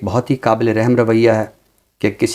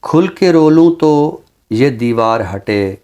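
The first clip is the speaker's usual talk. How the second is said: ghazal recitation. Acted. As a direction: savor each line like a mushaira reading. (धीमी, ठहरी हुई ग़ज़ल-ख़्वानी)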